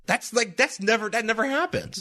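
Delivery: high, surprised disbelief; amazed. Retold or imitated. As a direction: marvel at something rare. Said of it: "I was like".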